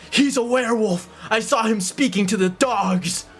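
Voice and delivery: high-pitched mob accuser; accusing villager. Acted. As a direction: cry out an accusation from the crowd.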